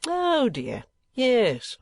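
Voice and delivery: high, pleased exclamation; delighted. A narrator's reading. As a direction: lift it up with delight. Kenwigs delighted by the news.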